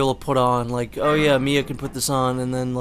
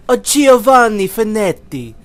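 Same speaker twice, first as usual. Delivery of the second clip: bad Italian accent; 9 semitones higher, 10 dB louder than usual. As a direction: belt it out in an over-the-top Italian accent. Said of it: "like a, a bad Italian accent".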